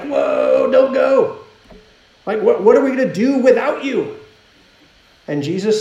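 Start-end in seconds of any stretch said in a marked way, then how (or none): 0.0-4.2 mock panicked pleading. Disciples begging Jesus not to leave them, acted panicked pleading. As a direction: act out a loud, alarmed plea.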